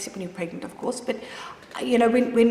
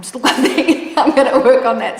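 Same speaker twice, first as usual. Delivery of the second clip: louder, laughing through the words; laughing at herself; 4 semitones higher, 12 dB louder than usual. Real, not acted. Mother admitting she's still quite big, laughing at herself. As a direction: laugh it off, self-mocking. Laughing about her weight.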